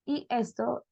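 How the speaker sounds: ordinary talk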